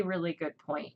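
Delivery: normal talking voice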